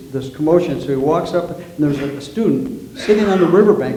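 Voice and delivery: ordinary talk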